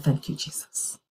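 breathless whisper of relief; whispered thanks. Retold or imitated. Preacher reliving her relief, whispered thanks. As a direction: whisper it like a prayer.

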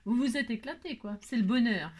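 normal talking voice

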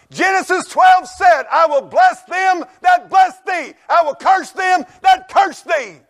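high, slow, shouted scripture; booming pulpit proclamation; preaching shout. Real, not acted. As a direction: thunder the verse out to the church. Preacher quoting scripture at full force.

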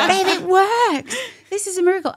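high, loud, laughing joy; overjoyed husband. Retold or imitated. Husband celebrating the positive test, high and laughing. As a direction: burst out delighted with a laugh. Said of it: "He was like".

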